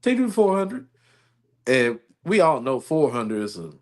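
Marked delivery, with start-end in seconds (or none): 0.0-0.9 imitated ex, pitched high and even; ex's calm voice. Ex asking for a ride after the breakup, imitated calm voice. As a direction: mimic the ex composedly in a high voice. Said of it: "she said a common voice".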